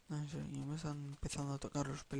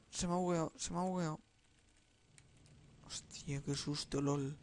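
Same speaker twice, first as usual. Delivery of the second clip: ordinary talk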